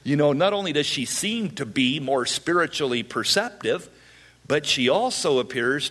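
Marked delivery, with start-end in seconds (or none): none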